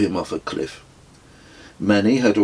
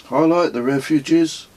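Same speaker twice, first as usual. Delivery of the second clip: irritated; 9 semitones higher than usual. Acted. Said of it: "plainly irritated".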